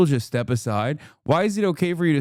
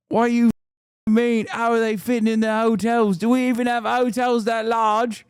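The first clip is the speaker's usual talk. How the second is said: higher, hammed-up outraged British lady; mock outraged Brit; 8 semitones higher than usual. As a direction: play her shocked panic over the numbers. Imitating the racist woman.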